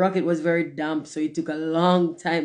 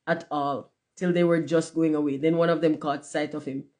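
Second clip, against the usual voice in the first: ordinary delivery, nothing marked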